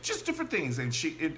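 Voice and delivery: ordinary delivery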